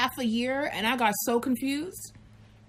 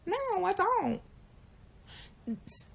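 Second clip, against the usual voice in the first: ordinary delivery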